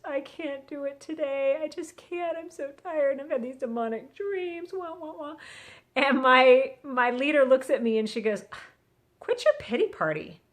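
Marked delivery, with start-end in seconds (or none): 0.0-5.4 high, soft self-pitying whine; poor-me imitation, pitched up and small; mock crying complaint. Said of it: "I was just crying in the car". 9.2-10.4 higher-pitched imitation of a leader's rebuke; brusque, no-sympathy retort; blunt retold scolding. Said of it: "how rude".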